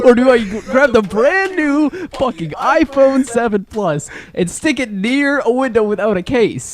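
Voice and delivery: high, slow, drawn-out disbelief; mock incredulity. Friend ridiculing Jason's choice to risk his new phone, mock incredulous.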